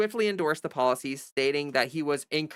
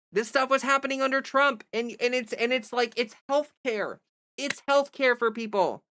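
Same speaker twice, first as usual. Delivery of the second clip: exasperated; 7 semitones higher than usual. Real, not acted. Exasperated rebuttal.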